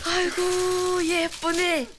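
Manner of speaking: slow, high admiring coo. Acted. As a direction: coo over the pretty dress. Admiring dress for child.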